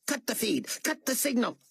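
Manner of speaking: high barked commands; raging commands; furious orders. A narrator's reading. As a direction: snap them out in fury. Carpathia screams orders on phone.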